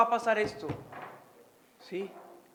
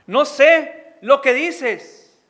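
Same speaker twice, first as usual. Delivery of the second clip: louder, slow, hammering denial; emphatic denial; 3 semitones higher, 13 dB louder, much slower than usual. Acted. Peter insisting to the crowd in a slow, loud denial.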